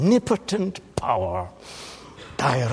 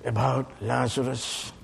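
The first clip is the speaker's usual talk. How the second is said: normal talking voice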